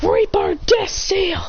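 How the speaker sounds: big dramatic jutsu shout; jutsu call. Acted. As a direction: yell the technique name like an anime hero.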